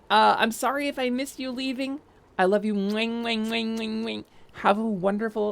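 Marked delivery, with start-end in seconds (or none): 2.7-4.2 kiss sounds. Acted. Goodbye kisses to Annie.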